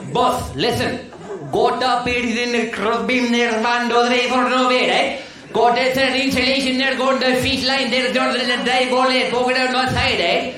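put-on loud Indian-accented worker explaining. Worker Sanjeet explaining wiring to boss, loud Indian accent. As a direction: rattle off the plan as an eager Indian worker.

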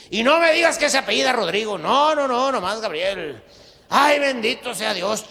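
loud, high, relieved outburst; loud relief. Acted. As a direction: blurt out the relief loudly. (alivio a gritos, agudo)

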